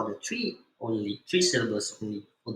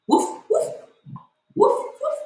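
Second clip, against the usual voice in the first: high playful woof woof like a puppy; dog barking; 6 dB louder than usual. Shown as a demonstration. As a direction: bark like a dog for the kids. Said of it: "you make a sound of".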